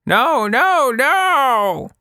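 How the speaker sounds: crying out. A narrator's reading. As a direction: wail the no's in alarm. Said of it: "she cried".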